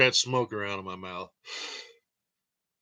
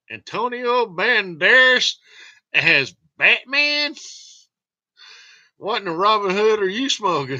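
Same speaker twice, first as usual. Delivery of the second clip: mock outrage; 8 semitones higher, 6 dB louder than usual. To viewers, mock outrage at Banderas as Batman. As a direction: recoil at the casting idea. Incredulous reaction to casting idea.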